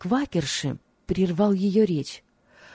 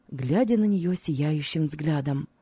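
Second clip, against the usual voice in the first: ordinary delivery, nothing marked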